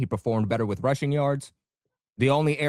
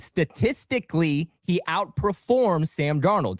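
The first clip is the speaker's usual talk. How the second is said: slow, deliberate; 2 semitones higher, much slower than usual. Real, not acted. [name] restating his stat claim to Richie, very slow and deliberate. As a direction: spell it out word by word, very slow. Spelling out his point slowly.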